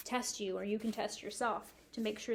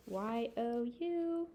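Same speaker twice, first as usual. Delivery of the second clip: slow spelling; 4 dB quieter, much slower than usual. Shown as a demonstration. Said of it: "spelling while writing".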